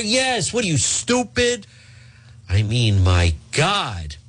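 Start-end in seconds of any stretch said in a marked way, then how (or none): 0.0-1.7 high mock scornful snap; hammed-up insult to a staffer; sneering at the aide in mock rage; mock angry snap. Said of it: "Yelling at Eva Mancuso".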